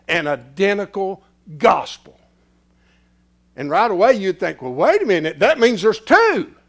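loud, high preaching; preaching burst. Acted. Preacher voicing the listener's doubt, loud. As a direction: raise it up again.

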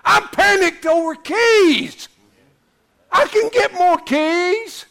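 slow, high, drawn-out shout; panicked. Retold or imitated. Preacher showing congregation how panicked he was over keys, shouting. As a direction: wail it loudly in exaggerated panic. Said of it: "telling you how panicked I was".

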